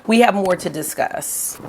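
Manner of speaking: normal talking voice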